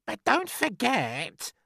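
high, drawn-out aunt voice; crying out. A narrator's reading. Aunt Spiker needling her sister in rhyme, crying out. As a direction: cut in with a slow, high cry. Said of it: "Aunt Spiker cried".

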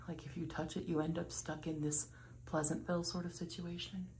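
hushed whisper in a waiting room. Real, not acted. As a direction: keep it to a whisper so no one hears. Whispering in doctor's office.

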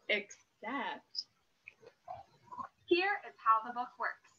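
slow, earnest storybook reading; reading to children. Acted. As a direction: read it like a serious bedtime story. Reads the book to children.

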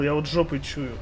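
normal talking voice

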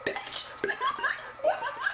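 barely audible, voice gone; lost voice. Shown as a demonstration. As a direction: croak it out with no voice. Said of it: "a whole lot of this".

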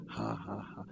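soft, low faith laugh; quiet mock laughter at the enemy; a demonstration laugh, held back; demonstrated laugh. Shown as a demonstration. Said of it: "Just try to laugh".

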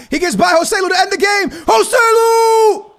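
fast rising call into a long, high, loud yell of the name; goal scream. Real, not acted. Gamer screaming the game-winning goal, euphoric. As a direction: build it up and explode on the name.

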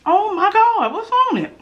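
high, amazed oh my God; delighted surprise at the taste; surprised. Real, not acted.

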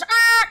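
loud, high imitated train horn; sudden loud "ah" as a train; train noise. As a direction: blare it like a train. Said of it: "and a train goes".

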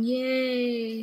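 dry fake cheer; deadpan yay, not meaning it; sarcastic.

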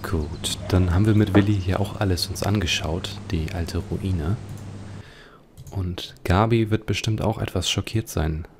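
usual speaking voice

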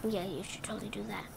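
fast little whisper to herself; whispered aside. Real, not acted. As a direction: breathe it out as a quick secret aside. Quick aside to the book.

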